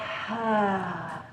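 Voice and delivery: long open-mouthed sigh out; audible sigh. Shown as a demonstration. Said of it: "Sigh it out through your mouth".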